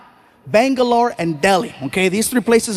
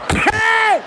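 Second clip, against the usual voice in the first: high startled hey; startled yelp; 12 semitones higher than usual. Retold or imitated. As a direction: yelp as the car guns it. Startled yell in car.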